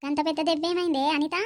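usual speaking voice

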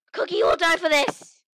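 loud furious threat; angry threat. Acted. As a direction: yell the threat, full of rage. Threatening player in game.

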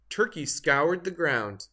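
ordinary delivery

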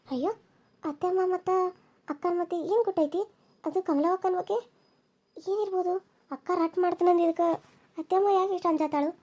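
soft wondering inner monologue; inner thoughts. Acted. Daughter-in-law wondering to herself about the secret, soft inner voice. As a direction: think it quietly to yourself.

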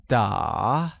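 打 dipping low, then rising; drawn-out low dip on 打 with rising tail; falling-rising tone. Shown as a demonstration. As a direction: sink deep on 打 and lift the end. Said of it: "pronounced using a falling rising tone".

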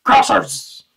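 CROSSERS blurted loud with a hard R; loud "Crossers", the R hit hard. Acted. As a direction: punch out "Crossers" with an exaggerated R. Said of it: "That's a pretty hard R you use there".

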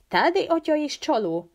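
high-pitched, alarmed question; frightened question. A narrator's reading. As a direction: ask it startled, voice jumping up high. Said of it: "kérdezte Frank ijedten".

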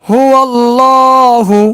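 tajweed recitation; Quranic recitation. Shown as a demonstration. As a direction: chant it with a thick ল. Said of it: "মোটা করতে হচ্ছে".

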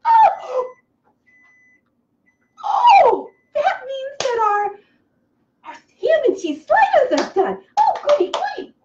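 high, loud, gleeful outburst; overjoyed, very high-pitched cheering; giddy excitement. Real, not acted. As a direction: squeal that the food is ready. Timer means sliders are done.

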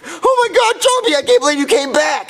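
high, excited gush; overjoyed. Acted. Boy greets his returned dog, overjoyed. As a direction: gush in joyful disbelief.

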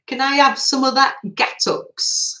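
imitating a Northern English relative asking. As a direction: put on a Northern English family accent, loudly. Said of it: "what they used to say".

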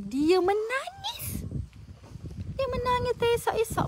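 very high, drawn-out voice; astonished retelling. Retold or imitated. Host recounts to viewers how the woman wept, high drawn-out astonishment. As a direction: stretch it out high in amazement.

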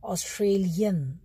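ऑस्ट्रेलियन said slowly, syllable by syllable; careful model word. Shown as a demonstration. Teacher modelling the nationality word, slow and clear. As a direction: stretch out ऑस्ट्रेलियन for the class. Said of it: "Australia के लोगों को क्या बोलते हैं".

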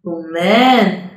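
ordinary delivery

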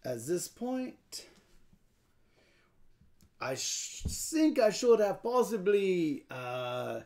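slow put-on French accent, lifting at the end; hammed-up French deliberation; weird French voice. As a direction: lament it like a mock Frenchman. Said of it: "you get to talk in a weird voice".

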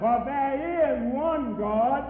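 slow emphatic echo. Real, not acted. Echoing scripture slowly.

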